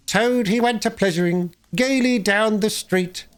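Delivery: high, squeaky sing-song, slowing at the end. Acted. Chief Weasel singing his mocking song, high and squeaky. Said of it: "began in a high squeaky voice".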